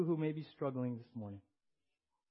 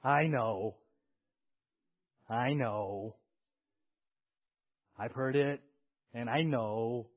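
louder, weary protest; imitating a tired congregant; 7 dB louder, much slower than usual. Pastor imitating a burdened listener saying I know, louder. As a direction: brush it off, I know. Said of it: "you would probably say this".

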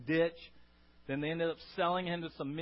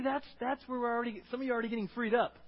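ordinary delivery

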